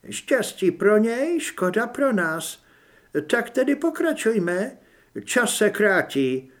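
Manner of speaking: high, slow, coolly superior voice; cold young woman. Acted. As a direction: dismiss it calmly but menacingly. Chladná žena rozhoduje. (chladný pomalý hlas mladé ženy)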